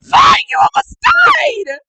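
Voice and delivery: very high, drawn-out shout; furious scolding. Acted. Kira shaking Hyden for risking death, furious high shout. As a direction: yell it in outraged disbelief. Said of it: "shaking him, like".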